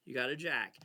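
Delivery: usual speaking voice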